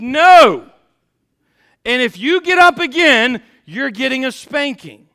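loud, high retold mom's threat; booming mock-angry parental warning; changed, stern tone. Mom losing patience with son at bedtime, stern and loud. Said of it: "Her tone of her voice changed".

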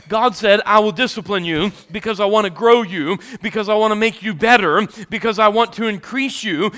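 loud, high pulpit preaching; rising loud sermon delivery; preaching at full force. Acted. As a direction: preach it out to the whole congregation.

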